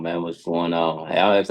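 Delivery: usual speaking voice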